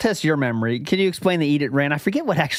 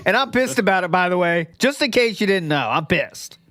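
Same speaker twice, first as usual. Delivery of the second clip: parent vibes; 3 semitones higher than usual. Retold or imitated. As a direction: hammer home the fake annoyance like a parent. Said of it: "extreme parent vibes".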